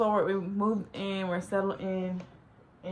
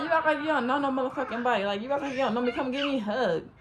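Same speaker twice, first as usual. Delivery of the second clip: higher, insistent acted scolding; mock indignant greeting; 5 semitones higher, 3 dB louder, faster than usual. Catfish demands a hug from her, indignant. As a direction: act put out that she ignores you.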